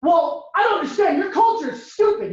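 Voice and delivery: high-pitched indignant outburst; heated kids' quarrel. Acted. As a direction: lash out like an exasperated kid, pitched high. Acted quarrel between camp kids.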